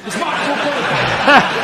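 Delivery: laughing through the catchphrase. Acted. Comedian laughing at his own refrain. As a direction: chuckle it out, slowed.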